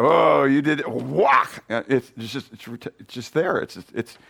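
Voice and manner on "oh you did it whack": mock city retort. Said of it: "it's like".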